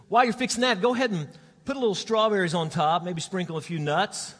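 high, loud calling from the next room. Retold or imitated. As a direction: shout the order after him. Wife calling orders to kitchen.

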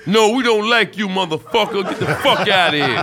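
high, loud jeering crowd voice. Retold or imitated. Crowd yelling at the comic on stage, loud jeering. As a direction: yell it like the whole hostile crowd.

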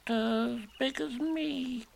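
high, drawn-out little voice; tiny high-pitched animal voice, slowly; small, timid Piglet. Acted. Piglet tells Eeyore the balloon's size, small timid voice.

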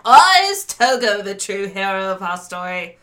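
put-on old radio announcer. Joins the radio teaser.

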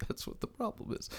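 breathy whispered aside to himself. Real, not acted. Frustrated guest whispers to himself, forgetting a name. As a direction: drop to a whisper mid-thought. Muttered aside while searching memory.

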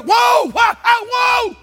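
very high, loud yell; alarmed warning shout. Acted. Warning a friend backing off a cliff, alarmed shout. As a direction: yell to stop a car at the edge. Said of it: "You're trying to get their attention".

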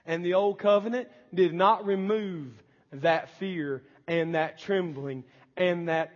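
normal talking voice

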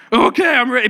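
high, loud half-sobbing "I'm ready"; mock crying kid. Pastor imitates crying boy on stage facing the lion, high tearful voice. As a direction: imitate a scared boy crying but brave. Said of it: "but he's crying now".

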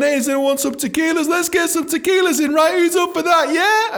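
high, loud, climbing party-starter pitch; rally the table, rising to the last yeah; hyping up the table. Retold or imitated. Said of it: "I'm like, I'm going".

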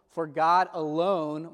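"alone" drawn out slowly and firmly; stress on alone. Shown as a demonstration. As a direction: lean on "alone", slow and emphatic. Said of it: "begins with an emphatic on-, al-, alone".

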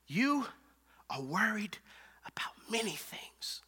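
very soft, sinking into a whisper; gentle hushed address. Acted. Jesus tells Martha what troubles her, near whisper. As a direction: let it fade to a whisper, word by word.

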